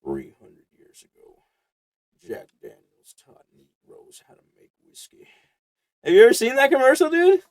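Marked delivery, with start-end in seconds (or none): 0.0-5.3 low, soft, deep announcer voice; mock ad narrator. Friend parodying a Jack Daniels commercial voiceover. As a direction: intone it like an old whiskey ad. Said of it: "And it's like".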